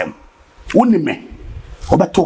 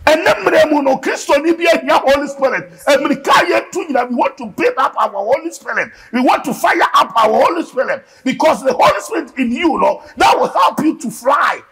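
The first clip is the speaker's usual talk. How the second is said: raised, pushing preacher's call to the congregation; fiery preaching; 8 semitones higher than usual. Acted. Preacher rousing the church about the Holy Spirit, fiery. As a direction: preach it up with fire.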